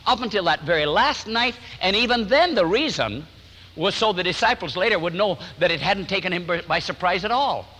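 normal talking voice

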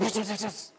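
quick hissing flashes made by mouth; imitated lightning. Storm outside as he prays, imitated lightning flashes. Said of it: "los relámpagos".

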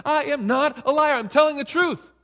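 loud, high, insistent protest; emphatic denial. Retold or imitated. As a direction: stamp your feet and insist. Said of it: "you're gonna get emphatic and you're jump up and down".

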